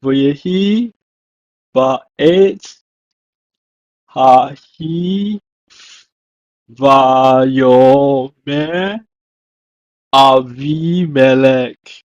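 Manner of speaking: slow, careful Hebrew phrase read aloud. Shown as a demonstration. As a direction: read the Hebrew word by word with pauses. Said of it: "it's rendered as".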